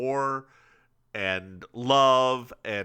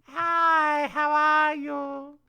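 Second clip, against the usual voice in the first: put-on high, sweet old-lady greeting, slow at the end; grandmother's greeting; 13 semitones higher, much slower than usual. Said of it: "sounded like your grandmother".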